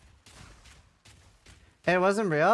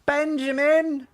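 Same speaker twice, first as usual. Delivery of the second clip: calling out; 12 semitones higher, 6 dB louder, much slower than usual. Pretend. Calling out to a teammate.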